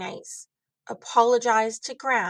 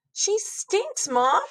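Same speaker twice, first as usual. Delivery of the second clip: put-on child whine; 9 semitones higher, slower than usual. Child complaining about lady's smell.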